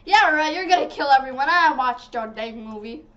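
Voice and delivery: loud, fast, sassy comeback; sassy loud retort. Retold or imitated. A girl telling Chucky off, loud and fast. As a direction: call his bluff out loud and quick. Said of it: "I'll be like".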